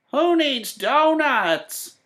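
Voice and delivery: high, slow despairing cry; old woman crying out. A narrator's reading. The old woman in the coffee crying to Sam, high slow cry. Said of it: "cried the old woman".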